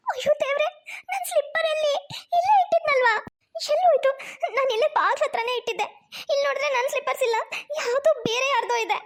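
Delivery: high, worried bride voice; panicked bride. Acted. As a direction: search in alarm for the slippers. Bride finds slippers missing.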